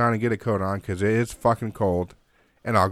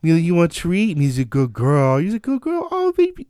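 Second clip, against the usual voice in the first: real nice; 6 semitones higher, 3 dB louder than usual. Retold or imitated. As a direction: coo to a runaway dog so she'll come close. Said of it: "So I start getting real nice".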